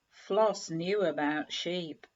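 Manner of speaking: usual speaking voice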